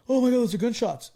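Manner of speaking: high, loud mock alarm; retold alarm. Man filming hears shots inside the store, imitated alarm. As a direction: imitate someone startled by gunfire. Retold filmer reacts to gunshots.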